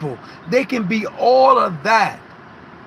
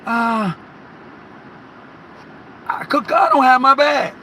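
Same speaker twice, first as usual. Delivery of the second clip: frustrated outburst; 6 semitones higher than usual. Retold or imitated. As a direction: blurt it out like you've lost your bag for good. Frustrated, can't find bag.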